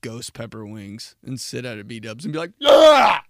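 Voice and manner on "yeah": loud whooping yell; bar cheer. Retold or imitated. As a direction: whoop like a sports fan.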